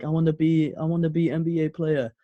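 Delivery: lightly put-on voice of a hopeful kid; imitating young Giannis. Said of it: "where he's like".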